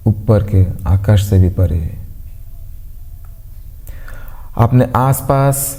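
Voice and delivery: calm, evenly paced meditation voice with long pauses; guided meditation. Acted. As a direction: guide them gently into stillness, pausing between instructions. (शांत, ठहराव भरी ध्यान कराने वाली आवाज़)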